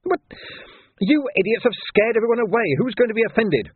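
high-pitched exasperated scolding; angry outburst. A narrator's reading. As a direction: berate them in high indignation. Arrested man berates the policemen.

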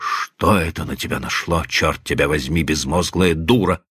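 low, gruff scolding; rough, rude. A narrator's reading. Husband scolding his wife once Rita is carried out, rough and low. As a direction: curse her roughly, pitch dropped well down. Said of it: "грубо сказал он".

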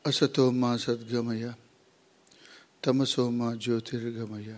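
soft, even chant with pauses between phrases; hushed, measured prayer recitation; Sanskrit prayer. Real, not acted. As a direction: recite the invocation quietly and evenly. Opening Sanskrit prayer before the lecture.

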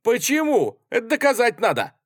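high, loud demand; indignant objection. A narrator's reading. Artskhanov demands proof from the soldier, indignant. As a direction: object hotly, pitch up high.

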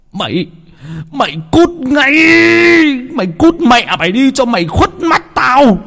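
shouting. A narrator's reading. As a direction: throw the son out of the house. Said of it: "mà hét".

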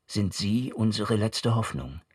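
ordinary talk, nothing marked

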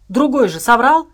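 an angry barked retort. A narrator's reading. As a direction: snap the accusation out. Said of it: "рявкнул Медвежонок".

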